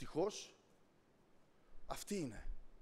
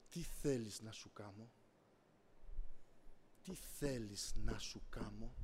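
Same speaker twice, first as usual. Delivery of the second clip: very low, soft, measured question; the Lord's voice; 6 semitones lower, 4 dB quieter, slower than usual. Retold or imitated. The Lord asking the preacher what he wants, low and solemn. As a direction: ask it deep and quietly, drawing it out.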